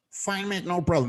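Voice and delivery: casual Aussie-style reassurance, lightly done; imitating Ernie. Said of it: "That's how he talks".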